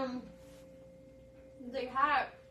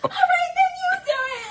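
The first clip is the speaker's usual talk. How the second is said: fed-up grandma; 11 dB louder than usual. Retold or imitated. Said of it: "my grandma was like".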